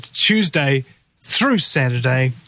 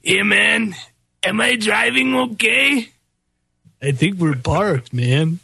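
slow, spaced-out stoner; 4 semitones higher, slower than usual. Retold or imitated. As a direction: play a dazed stoner. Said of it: "the genius of [name] and [name]".

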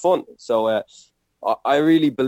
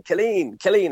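teacher shouting; 9 semitones higher than usual. Retold or imitated. Retelling teacher calling the boy who ignores her, high mock shout. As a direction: imitate a teacher repeatedly calling a pupil. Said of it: "she'd keep shouting".

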